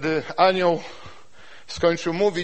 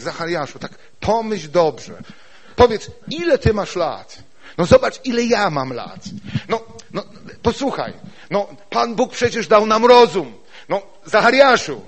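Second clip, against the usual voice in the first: lightly raised, louder persuading voice; voice of common sense; 5 semitones higher, 5 dB louder than usual. Retold or imitated. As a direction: talk him out of it with reason. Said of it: "teraz zdrowy rozsądek". (lekko podniesiony głos zdrowego rozsądku)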